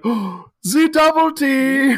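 high, loud, slow put-on German voice; mock German gasp. The Germans awed by the double team, slow and high. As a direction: marvel at it in a hammed-up German voice. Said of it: "the Germans are like".

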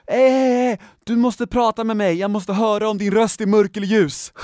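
loud, high-pitched retold shout; frantic screaming. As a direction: yell desperately at the cashier. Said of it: "skriker till tjejen i kassan". (skriker i panik, återberättat)

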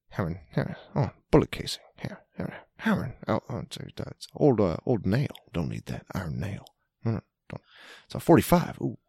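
mumbled half-words, a hint of Claypool muttering to himself; lightly imitating Claypool mumbling as he metal-detects; [name] mumble. Host imitating Claypool's mumble while hunting with a metal detector, lightly mumbled. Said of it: "doing that classic [name] mumble".

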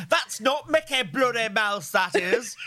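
put-on doing dad's Liverpool accent, drawn out; imitating Liverpool accent. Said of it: "And my famous quote was".

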